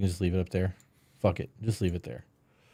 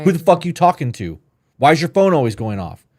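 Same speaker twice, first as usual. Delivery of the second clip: louder, higher, demanding and accusing; nasty accusation; 5 semitones higher, 6 dB louder than usual. Shown as a demonstration. Jealous partner grilling about phone pings, nasty accusing. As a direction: snap it out like you suspect cheating. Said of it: "There's a nastiness there".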